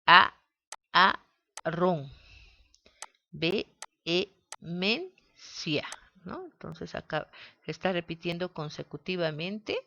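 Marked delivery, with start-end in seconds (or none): 0.0-2.1 A, a, ron spoken as separate beats; syllables split. Shown as a demonstration. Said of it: "Si la separamos". 3.3-5.9 Ve, e, men, cia spoken as separate beats; syllables split. Shown as a demonstration. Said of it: "Si la separamos".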